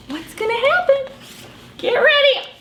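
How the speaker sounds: high, eager build-up; excited suspense. Acted. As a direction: build it up for the kids.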